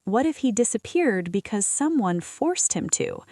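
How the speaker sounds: plain reading voice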